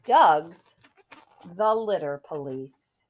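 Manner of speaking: put-on lively rhyming read-aloud for kids; story narration. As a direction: read it like a bedtime picture book. Reads picture book aloud.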